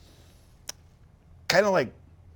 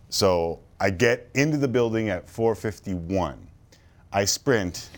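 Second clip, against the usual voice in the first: ordinary delivery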